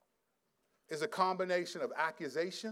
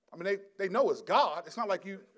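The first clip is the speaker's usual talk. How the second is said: normal talking voice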